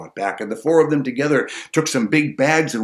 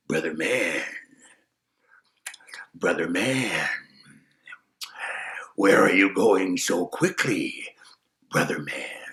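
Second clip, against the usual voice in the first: sly wolf voice; 5 semitones lower, slower than usual. Acted. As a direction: lure him in like a hungry wolf. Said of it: "It was the hungry wolf".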